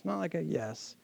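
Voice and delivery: limp, quiet yes; a half-hearted mumbled yes; weak yes. Shown as a demonstration. Pastor showing the congregation a weak answer, flat. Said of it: "But I mean like a hearty yes".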